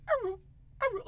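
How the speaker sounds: short, high "aroo" yelp; high-pitched doggy yowl; imitated dog howl. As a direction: mimic a dog's first odd bark. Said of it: "like a sound I'd never heard before".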